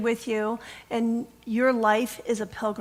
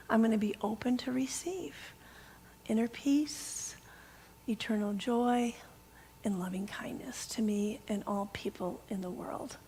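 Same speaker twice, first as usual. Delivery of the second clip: softer whispered vow; whispered intention; 10 dB quieter than usual. Real, not acted. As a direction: breathe the words like a private prayer. Whispering her personal intention.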